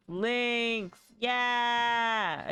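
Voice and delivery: high, loud sign-off call. Real, not acted. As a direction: call it out to chat.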